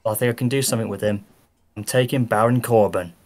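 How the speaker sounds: ordinary talk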